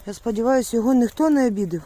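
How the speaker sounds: ordinary talk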